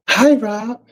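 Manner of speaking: high, bright eager greeting to Rob; bit of excitement. Retold or imitated. Imitating the ladies greeting Rob with excitement. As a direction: sound thrilled to see him. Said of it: "he said that with a little bit of excitement".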